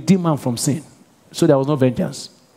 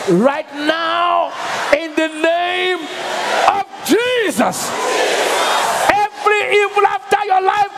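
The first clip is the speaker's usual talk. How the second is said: preaching at full force. Real, not acted. Pastor storms heaven for his congregation, shouting at full force. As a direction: thunder the prayer over the church. Pastor leads vengeance prayer.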